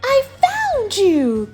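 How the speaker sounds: high, stretched, delighted call; happy cry. Acted. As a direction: cry it out slowly with a big grin. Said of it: "cried George with a big happy grin".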